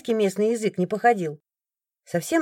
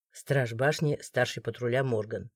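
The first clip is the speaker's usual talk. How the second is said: much lower, softer stern question; cold authority, no need to shout; stern guard; 9 semitones lower, 9 dB quieter than usual. A narrator's reading. The guard questioning the crowd, low stern voice. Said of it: "представился мужчина".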